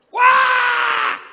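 high mock baby wail "Wah!". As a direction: wail like a newborn. Imitating a crying baby.

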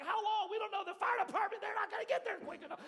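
high, loud anxious outburst; the fear spilling over; frantic. Retold or imitated. Father racing to his locked-in baby, frantic. Panicked exchange over fire department.

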